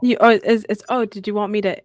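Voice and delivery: ordinary talk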